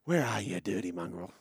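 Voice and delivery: low, soft, menacing growl of a villain; Avatar villain line. Retold or imitated. Host quoting the actor's villain line in a low menacing voice. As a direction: hush it low like a bad guy hunting. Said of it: "I do like the one when you say".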